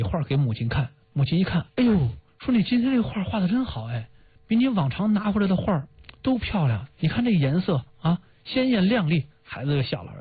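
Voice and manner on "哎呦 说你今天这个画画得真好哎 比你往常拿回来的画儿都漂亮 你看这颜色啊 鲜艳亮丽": praising mother. Retold or imitated. Mother praising child's drawing.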